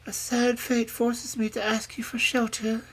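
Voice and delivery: high-pitched, sorrowful plea; tearful princess. A narrator's reading. Princess asking the old woman for shelter, tearfully. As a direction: plead for help as a tearful princess. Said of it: "with her tears in her pretty eyes".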